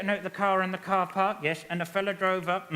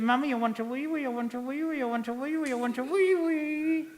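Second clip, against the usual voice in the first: little grandson; 8 semitones higher than usual. Retold or imitated. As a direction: imitate a small boy pestering Mummy. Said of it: "Me grandson. / He said".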